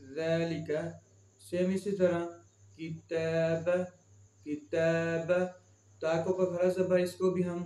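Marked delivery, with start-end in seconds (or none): 0.1-0.7 long held first vowel in ज़ैलिक; stretched vowel. Shown as a demonstration. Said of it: "खींच कर पढ़ेंगे". 2.8-3.7 long held middle vowel in किताब; stretched vowel. Shown as a demonstration. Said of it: "खींच कर पढ़ेंगे". 4.5-5.3 long held middle vowel in किताब; stretched vowel. Shown as a demonstration. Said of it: "खींच कर पढ़ेंगे".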